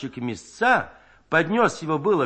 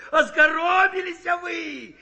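high words broken by laughter; laughing fit; 13 semitones higher, 4 dB louder, much slower than usual. A narrator's reading. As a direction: gasp it out through helpless laughing. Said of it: "покатываясь со смеху".

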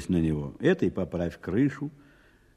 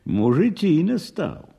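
gasping admiration; 6 semitones higher, slower than usual. Acted. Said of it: "Варвара ахнула".

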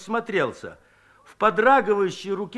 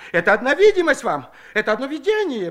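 quick, higher wheedling; sly dodge; 7 semitones higher, 5 dB louder, faster than usual. A narrator's reading. Shchukar tries to trick the women, quick and sly. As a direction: try to talk your way out. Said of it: "пробовал схитрить Щукарь".

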